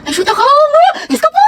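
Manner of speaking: giggly, rising to a high squeal; flustered giddiness. Acted. Girl sees crush coming, flustered high giggle. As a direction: panic giddily with a laugh.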